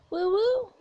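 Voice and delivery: high two-note robot coo; high-pitched little bot sound; cute robot noises. Acted. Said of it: "the sounds he always makes".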